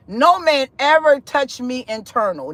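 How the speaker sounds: usual speaking voice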